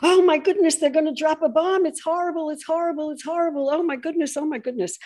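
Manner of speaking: high, loud mock hysteria, fast at first; mock panic. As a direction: ham up the frantic alarm.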